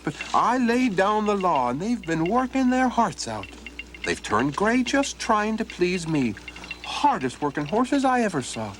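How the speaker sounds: high, innocent-sounding lad; cheeky Donald. Acted. Donald innocently explains the gray horses to the old man, high and cheeky. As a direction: play it wide-eyed and innocent.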